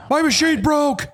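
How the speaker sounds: very high, slow mock whine; frustrated protest. Trenching worker repeating his excuse to the customer, mock frustrated. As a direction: imitate a helpless excuse, squeakily drawn out. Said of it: "the guy is also frustrated".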